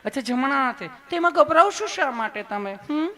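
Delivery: high put-on nurse voice; sweet, loving nurse. Nurse asking why the patient is nervous, high and sweet. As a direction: reassure coaxingly, pitched right up. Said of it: "તમે કેટલું પ્રેમથી પૂછો છો".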